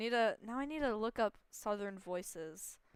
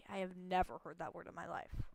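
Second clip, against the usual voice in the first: usual speaking voice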